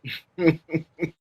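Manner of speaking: soft amused laugh; a gentle laugh, not mocking; kind gentle laugh. Real, not acted. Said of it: "Sorry, I'm not laughing at you".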